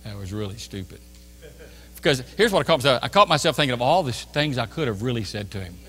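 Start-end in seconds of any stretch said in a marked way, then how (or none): 0.0-1.0 low, soft, deadpan verdict; quiet rebuke. Retold or imitated. Holy Spirit convicts preacher after leaving store, quiet rebuke. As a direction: drop low and soft to chide.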